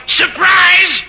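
high-pitched, sing-out yell of surprise; imitating your own excited shout; retold yell. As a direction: call it out high, as if unveiling something. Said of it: "turn around and yell".

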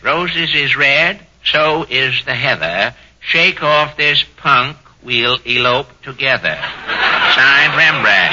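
deliberate comic reading with pauses between lines; reading verse aloud. Acted. It's writing.

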